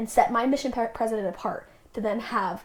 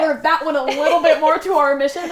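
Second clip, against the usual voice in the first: louder, higher, laughing through it; laughing sheepishly; 4 semitones higher, 11 dB louder than usual. Retold or imitated. Mission president sheepishly admits his goal was off, laughing. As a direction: admit the blunder while chuckling.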